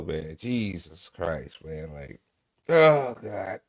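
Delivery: normal talking voice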